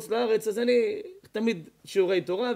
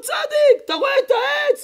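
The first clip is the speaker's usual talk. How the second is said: loud, high call across the river; 7 dB louder than usual. Retold or imitated. A bystander calling to the man in the current, high loud call. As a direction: call out to him urgently.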